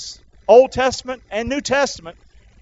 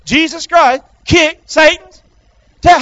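call for repetition; 7 semitones higher, 7 dB louder than usual. Shown as a demonstration. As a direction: lead the crowd, punching each chunk. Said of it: "you might not used to be speaking like that".

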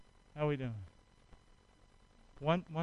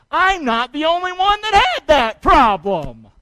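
slow, loud, higher voice of sudden relief; relieved inner thought; 10 semitones higher, 15 dB louder, slower than usual. Retold or imitated. Preacher voicing a believer who realizes they are not alone, slow and relieved. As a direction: draw out the realization as if a weight lifts. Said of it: "to realize".

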